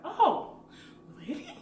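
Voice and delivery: surprised. Retold or imitated. Newcomer to the person who laughed, surprised. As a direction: play your younger self caught off guard. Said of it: "I'm like".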